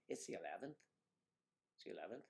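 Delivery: soft Irish lilt, rising at the end; low, tentative Irish imitation; Irish accent. Shown as a demonstration. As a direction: quietly try out an Irish accent. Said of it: "now I'm going back to Ireland".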